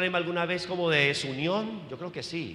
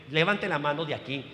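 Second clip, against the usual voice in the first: ordinary delivery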